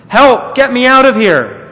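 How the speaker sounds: loud, high desperate yell; yelled for help. Acted. Teacup trapped in the oven yelling for help, loud. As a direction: yell to be let out. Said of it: "I yelled and knocked and pounded".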